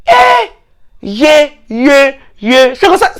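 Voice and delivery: high shouted yé yé; mock outrage. Teller mocking Ngoran's name change, high shouted yé yé. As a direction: cry out in disbelief.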